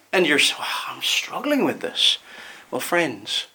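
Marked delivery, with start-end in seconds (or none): 0.9-2.2 hushed, breathy confession of doubt; whispered doubt. Retold or imitated. Preacher voicing a doubting worshipper in a whisper. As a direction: whisper it like a private struggle.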